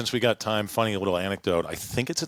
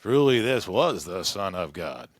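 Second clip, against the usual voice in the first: slow, lower drawled movie-cowboy delivery; John Wayne; 3 semitones lower, slower than usual. Retold or imitated. Podcast host imitating a film centurion's hilarious line, slow star impression. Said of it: "he sounds exactly like John Wayne".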